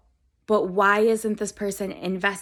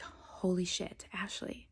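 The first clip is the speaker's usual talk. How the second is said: hushed, breathy whisper to herself; an astonished whisper to her reflection; 3 semitones lower, 16 dB quieter, slower than usual. Retold or imitated. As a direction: whisper it in awe at the mirror. Talking to herself in mirror.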